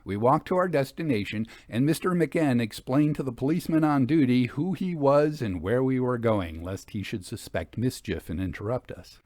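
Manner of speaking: usual speaking voice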